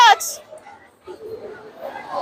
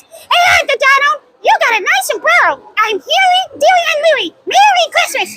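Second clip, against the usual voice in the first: higher cartoon kid voice; duck nephews voice; 5 semitones higher than usual. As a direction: chirp it like little cartoon ducklings. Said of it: "I do the voice of your nephews like this".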